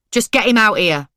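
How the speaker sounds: loud, demanding yell; shout. A narrator's reading. Narrator yelling at Ashtiak's mum, loud demand. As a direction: order him out at full volume. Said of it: "I shouted".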